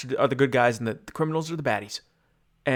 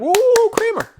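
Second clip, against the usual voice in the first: Kramer entrance; 8 dB louder than usual. Retold or imitated. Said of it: "then Kramer's like".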